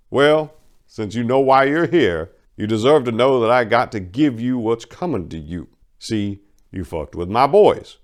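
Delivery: loud, high, taunting crime boss; Duke Willie's voice. Acted. Crime boss taunting Maria, high and loud. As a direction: lord it over the girl who crossed his boys.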